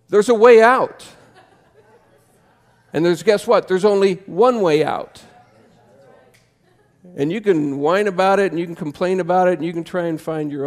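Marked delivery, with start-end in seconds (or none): 0.1-4.8 louder, much higher cry with a laugh; loud exclamation. Real, not acted. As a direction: call it out high and loud, laughing. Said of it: "preacher cries out the point".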